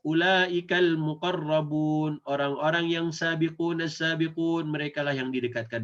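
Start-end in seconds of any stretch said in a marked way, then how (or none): 0.1-2.2 slow chanted "Ulaikal muqarrabun"; Quran recitation. Shown as a demonstration. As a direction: recite it like tilawah. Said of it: "ayat sebelas". (lantunan ayat pelan)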